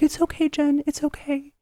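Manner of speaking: small, high, pleading reassurance; imitated soothing. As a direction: imitate a hurt friend gently calming someone down. Friend voiced calming the angry speaker.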